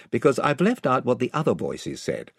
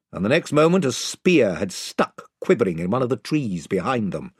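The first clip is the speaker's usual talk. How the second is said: ordinary delivery, nothing marked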